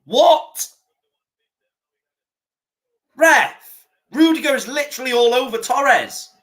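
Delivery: high, indignant call to the ref; pleading with the referee in disbelief; outraged appeal. Real, not acted.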